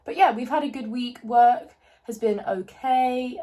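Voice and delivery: loud, slow mock French accent. Vlogger catching up with viewers, in a mock French accent. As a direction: play-act a French accent to the camera. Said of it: "I also keep doing the French accent".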